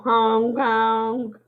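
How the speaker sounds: loud high honking clown noise; silly clown honk. Pretend. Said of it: "That is the sound".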